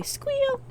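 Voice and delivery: very high pig squeal falling away. Acted. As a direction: squeal in delight. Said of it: "I don't understand your oinking".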